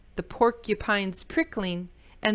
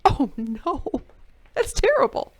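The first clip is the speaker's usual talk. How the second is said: dismay; 5 semitones higher, 4 dB louder than usual. Real, not acted. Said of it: "reacting to story".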